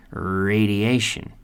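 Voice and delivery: slow "Radiation" with a long AY before the sh; corrected pronunciation of radiation. Shown as a demonstration. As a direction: model "Radiation" as ray-dee-AY-shun. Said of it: "or the pronunciation".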